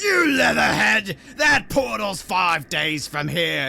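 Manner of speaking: loud, high indignant shout; scornful heckling head. Acted. Another head arguing over the directions, indignant shout. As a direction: yell the insult, then stretch the correction. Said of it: "someone else goes".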